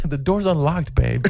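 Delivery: high, softened put-on come-on; mock flirty invitation. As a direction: coo it to a pretend lover. Plays man inviting his friend.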